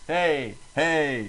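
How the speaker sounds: light Fonzie-style greeting; a hint of a cool greaser. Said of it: "That's the Fonz".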